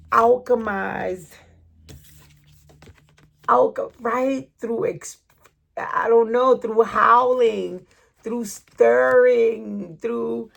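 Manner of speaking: slow, loud, drawn-out hype words; slow emphatic hype. Real, not acted. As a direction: build it up like an incantation. Hyped chanting of the spirit's journey.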